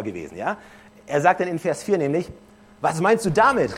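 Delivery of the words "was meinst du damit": a bit louder, higher, startled question; exclaimed. Acted. Nikodemus startled, asking Jesus out loud. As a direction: call out the question in mild disbelief. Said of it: "rief Nikodemus aus".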